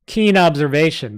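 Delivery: sarcastic New York goblin voice; a snide New York goblin mocks the knight, in a New York accent. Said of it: "my goblins from New York".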